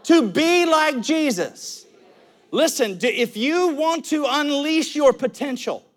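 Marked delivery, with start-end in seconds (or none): 0.0-1.8 call and response. Shown as a demonstration. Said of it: "Somebody say".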